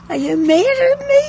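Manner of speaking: imitating a hurt, high-pitched question; plaintive customer. Regular customer to fussing bookseller, imitated plaintive worry. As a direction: ask it high and quick, mock-wounded.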